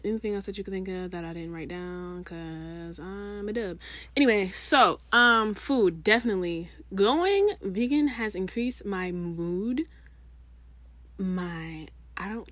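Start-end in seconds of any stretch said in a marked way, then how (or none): none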